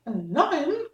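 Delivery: alarmed squeak. Acted. Mouse queen cries out in alarm at the Woodman's idea, high squeak. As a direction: gasp it out, alarmed. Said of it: "cried the little Queen".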